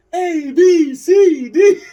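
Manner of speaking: manic shouted alphabet, way up high; hammed-up Nick Cage shrieking the ABCs; Nick Cage alphabet rant. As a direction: scream the letters like a madman. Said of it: "when he does that alphabet thing".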